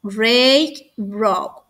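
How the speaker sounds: usual speaking voice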